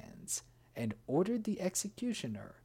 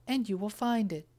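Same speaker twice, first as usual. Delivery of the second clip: higher-pitched ogress coaxing her prey; 7 semitones higher than usual. A narrator's reading. Ogress to the lost prince, high put-on voice. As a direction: speak up high, as the pretended princess. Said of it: "said the ogress".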